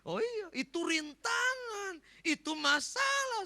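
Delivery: very high, drawn-out outburst; mock exclamation. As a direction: exclaim in exaggerated dismay. Preacher exclaims about flood as obstacle.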